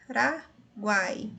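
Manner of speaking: Paraguai drawn out slowly; slowed teacher's Paraguai; slow syllable demo. Shown as a demonstration. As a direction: let Paraguai glide slowly. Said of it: "nosso exemplo mais utilizado de tritongo".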